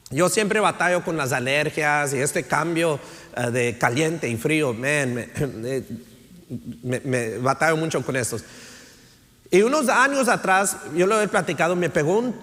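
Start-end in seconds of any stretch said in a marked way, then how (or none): none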